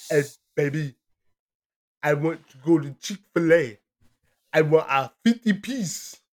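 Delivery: lightly put-on Greek accent; mock Greek accent in the car; Greek accent impression. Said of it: "with the shittiest fucking accent".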